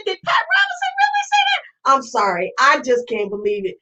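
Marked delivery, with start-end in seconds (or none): 0.0-1.6 much higher, fast, shouted disbelief; incredulous shriek. Real, not acted. Asking viewers if Pat Robinson said it, in shrieking disbelief. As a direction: fire it out way up high, can't believe it.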